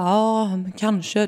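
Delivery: slow, drawn-out half-hearted agreement; slow doubtful husband. Retold or imitated. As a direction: mimic the husband brushing it off slowly. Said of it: "Och han bara".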